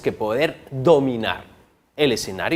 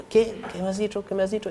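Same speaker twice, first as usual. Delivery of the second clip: straining listener; 5 semitones higher, 4 dB quieter, faster than usual. Retold or imitated. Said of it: "hablan como que para ellos nomás".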